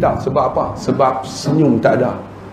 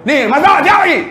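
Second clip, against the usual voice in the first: high-pitched, demanding outburst; angry spouse; 13 semitones higher, 3 dB louder, faster than usual. Acted. Husband checking on dinner, angry high-pitched demand. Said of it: "kalau suami marah".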